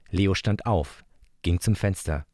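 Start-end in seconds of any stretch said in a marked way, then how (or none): none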